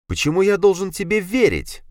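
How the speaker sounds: sceptical surprise. A narrator's reading. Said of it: "поднял я бровь в удивлении".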